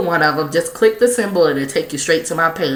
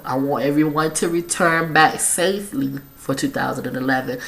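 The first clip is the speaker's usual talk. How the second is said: ordinary talk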